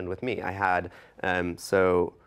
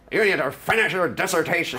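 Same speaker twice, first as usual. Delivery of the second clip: gruff bulldog of a professor, pitched up; growly bulldog voice; 7 semitones higher, 3 dB louder than usual. Retold or imitated. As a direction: bark it like a stern old bulldog. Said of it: "really kind of growly sort of bulldog voice".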